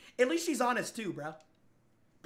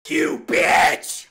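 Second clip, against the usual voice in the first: a sudden much louder, breathy yelled insult; mock outraged shout; 3 semitones lower, 12 dB louder than usual. Host jokingly yells at the guest, in a mock outraged shout. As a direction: blurt it out in fake outrage.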